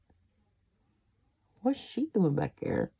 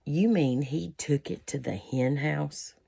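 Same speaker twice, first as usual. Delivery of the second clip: ordinary delivery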